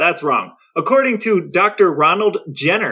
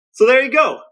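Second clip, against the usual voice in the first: higher and louder, a triumphant wrap-up; 9 semitones higher, 4 dB louder than usual. Real, not acted. Brother rounding off his science answer, triumphant. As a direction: land it like a mic drop.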